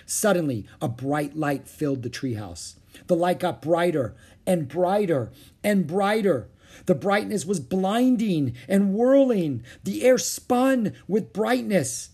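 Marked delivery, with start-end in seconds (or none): 3.1-12.1 swelling high, loud, dramatic narration; building awe. A narrator's reading. Narrator as magic light whirls through treehouse, building awe. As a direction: build it bigger and slower with each phrase.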